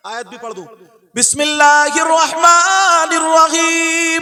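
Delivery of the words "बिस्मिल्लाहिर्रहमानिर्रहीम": high, very loud, slow chant; ringing melodic Arabic recitation; Quran recitation. Real, not acted. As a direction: intone the verse with long-held vowels.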